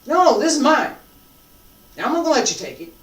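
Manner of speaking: sharp, loud claim of ownership; acted possessive anger. Shown as a demonstration. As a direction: snap it like guarding what's yours. Martial arts teacher acting possessive anger.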